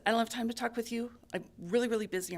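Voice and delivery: fast, loud and irritated; angry. Acted. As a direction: brush him off angrily. Rabbit snaps at Creator.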